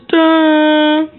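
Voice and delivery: loud mock computer boot dun; computer sound effect. Kid mimics the old computer chime.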